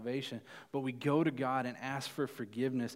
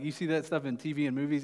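normal talking voice

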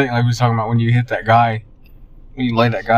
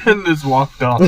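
laughing; 8 semitones higher than usual. Real, not acted. Laughing while saying.

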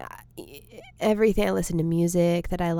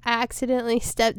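ordinary delivery

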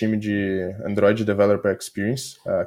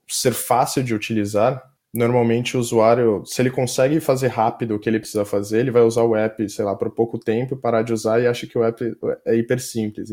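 ordinary talk, nothing marked

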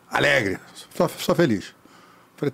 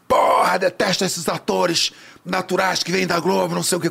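high-pitched, irritated rant; retold dressing-down; 5 semitones higher than usual. Director scolding a newcomer actor from Globo, angry rant. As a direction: imitate a director tearing into someone. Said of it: "Começou a me dar um pagadão".

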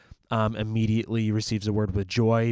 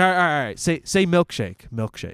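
higher, louder, imitated coaching; coaching pronunciation; 7 semitones higher, 5 dB louder than usual. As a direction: coax someone to repeat a word. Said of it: "He's like".

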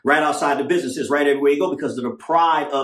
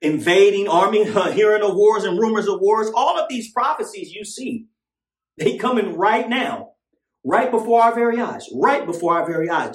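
doomsday street preacher; 5 semitones higher than usual. Acted. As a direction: preach the end times at full force. Fiery preaching on prophecy.

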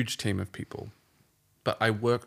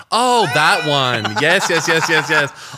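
excited recognition; 6 semitones higher, 10 dB louder than usual. Real, not acted. Sudden recognition.

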